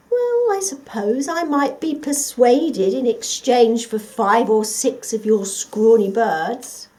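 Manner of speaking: slow, loud scheming fox; sly fox bargaining. Acted. The fox bargaining for the dog's birds, slow and sly. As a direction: lay out the crafty deal.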